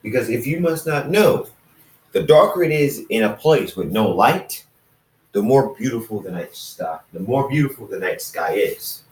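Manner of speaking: ordinary delivery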